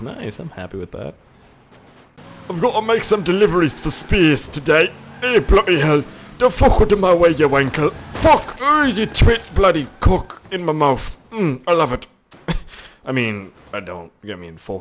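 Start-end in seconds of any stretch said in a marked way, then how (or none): 2.5-12.1 higher, louder hammed-up British accent; irate British driver, cartoonishly overdone accent; mock British accent. British driver making deliveries curses the road, mock British accent.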